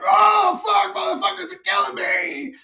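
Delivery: very high, loud yell of pain. Retold or imitated. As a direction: howl as if being cut. Baby being cut.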